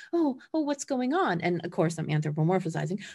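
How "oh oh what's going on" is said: high, startled worried voice; pitched-up fretful pup; anxious dog voice. Pretend. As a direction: voice the nervous dog asking what's wrong. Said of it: "instant reaction is like".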